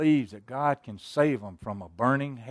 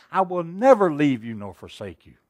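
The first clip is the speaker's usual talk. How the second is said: proclaimed promise; 8 dB louder than usual. Acted. Quoting God's promise, preaching.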